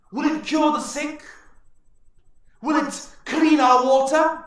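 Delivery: high, loud, booming war chief; orc chieftain ranting. Acted. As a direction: shout it like a defiant warlord, then question sharply. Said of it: "the orc chieftain says".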